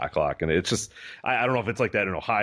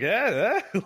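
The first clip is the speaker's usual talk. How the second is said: smug nudge; 10 semitones higher than usual. Retold or imitated. Coach nudging team, mock boast.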